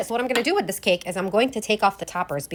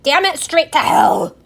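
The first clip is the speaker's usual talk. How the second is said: loud, slow exasperated curse; frustrated outburst; 7 semitones higher, 8 dB louder, slower than usual. Real, not acted. Baker cursing her ruined cake, loud and frustrated.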